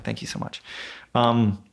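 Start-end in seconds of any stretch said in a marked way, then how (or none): 0.0-0.6 whispered thanks. Retold or imitated. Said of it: "I'm like".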